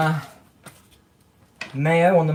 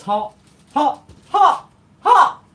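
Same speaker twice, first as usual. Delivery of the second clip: rising squeals, louder each time; high squeal; 12 semitones higher, 7 dB louder, much slower than usual. Real, not acted. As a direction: squeal it, higher and higher. Reacting to hot food.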